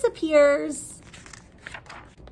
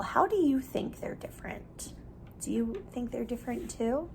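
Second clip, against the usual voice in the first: whispered question; 3 semitones lower, 10 dB quieter than usual. Real, not acted.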